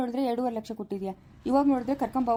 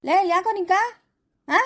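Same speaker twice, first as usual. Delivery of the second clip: higher, louder angry retort; angry outburst; 9 semitones higher, 5 dB louder than usual. Acted. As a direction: snap back hard at her. Husband snaps at wife.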